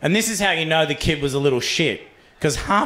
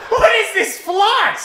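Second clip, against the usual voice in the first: shocked; much slower than usual. Retold or imitated. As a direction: squeal in disbelief, stretched slow. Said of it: "I'm like".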